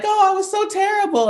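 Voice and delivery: put-on high-pitched self-critical little-kid wail; dismayed child. Young daughter running off stage after her first song, dismayed. Acting out herself as upset child.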